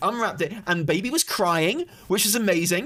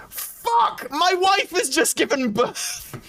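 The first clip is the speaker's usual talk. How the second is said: frantic husband to the collector; exasperated outburst; 11 semitones higher, slower than usual. Retold or imitated. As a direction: blow up at the stranger at the door. Exploding at charity collector.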